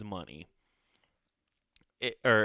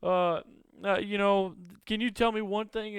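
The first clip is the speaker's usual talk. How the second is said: higher, breezy fake nonchalance; mock casual prisoner shrugging off the news; faking cool; 9 semitones higher, 5 dB louder than usual. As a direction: act totally unbothered, chirpy and high. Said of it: "trying to play it real cool".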